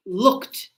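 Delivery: Looked as one syllable ending kt; not look-at, just Looked; ends in kt. Shown as a demonstration. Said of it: "you don't say look at".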